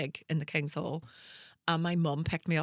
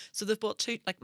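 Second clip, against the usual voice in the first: normal talking voice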